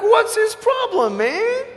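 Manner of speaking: imitating his younger self, irritated; annoyed inner reaction. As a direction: toss it off, baffled and fed up. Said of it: "And I'm like".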